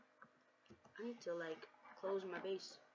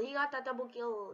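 usual speaking voice

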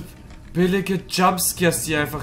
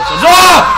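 startled scream; 14 semitones higher, 13 dB louder than usual. Real, not acted. Jumpscare scream.

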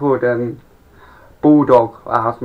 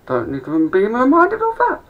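exasperated retort; 11 semitones higher than usual. Retold or imitated. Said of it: "I said".

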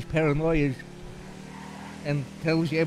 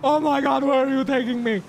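panicked; 8 semitones higher than usual. Acted. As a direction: panic as a kidnapped passenger. Playing kidnapped victim, panicking.

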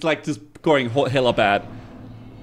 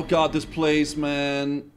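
ordinary talk

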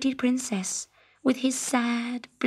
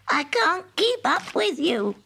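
frog voice; 7 semitones higher than usual. A narrator's reading. Frog calling after princess.

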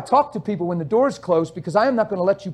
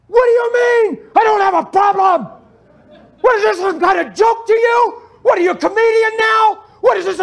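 much higher, loud furious shouting; screaming at God; 11 semitones higher, 5 dB louder than usual. Retold or imitated. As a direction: rage back at God with full force. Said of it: "he's screaming at God".

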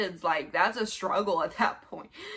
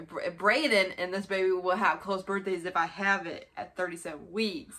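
ordinary talk

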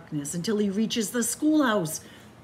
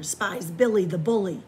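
usual speaking voice

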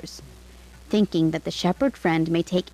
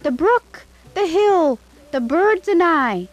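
high, louder call after a departing friend; 10 semitones higher, 4 dB louder than usual. A narrator's reading. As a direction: call out across the distance, eager. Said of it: "the shepherd called out".

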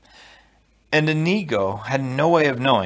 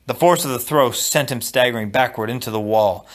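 ordinary delivery, nothing marked

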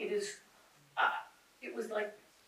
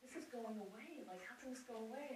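high, very soft, distraught thought; small, quiet voice on the edge of retold tears; retold tearful despair; 7 semitones higher, 14 dB quieter than usual. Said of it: "like, in tears, thinking".

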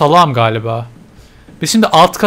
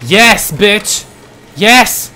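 loud, higher burst of victory; triumphant cheer; 4 semitones higher, 5 dB louder, much slower than usual. Real, not acted. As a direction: celebrate solving it. Puzzle solved. (zafer sevinciyle)

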